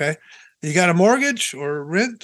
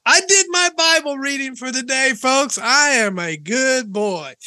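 raised, drawn-out mock pride; mock self-congratulation; 5 semitones higher, 3 dB louder than usual. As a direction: proclaim it with hammed-up pride. Mimics smug shallow Bible reader.

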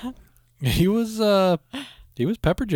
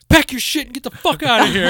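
mock angry girlfriend; 11 semitones higher, 4 dB louder than usual.

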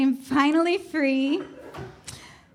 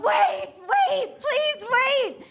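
retold yell; 9 semitones higher, much slower than usual. Traveler calling to the closing gate doors, high mock yell. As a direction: call out a mock-desperate plea, pitched way up. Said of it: "I'm yelling out".

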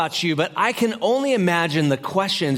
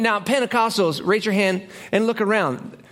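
ordinary delivery

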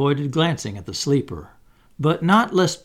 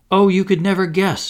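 high, louder fairy exclamation; excited cry; 6 semitones higher, 5 dB louder than usual. A narrator's reading. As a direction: tease with big news, pitched up. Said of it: "she cried".

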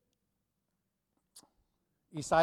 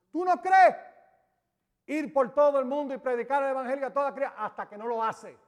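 high and loud, rushing through with a laugh; preacher's fast jubilant challenge; excited laughing exhortation; 6 semitones higher, 4 dB louder, faster than usual. Acted. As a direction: press the point with joy. Preacher exclaiming.

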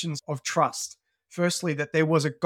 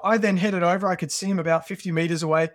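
usual speaking voice

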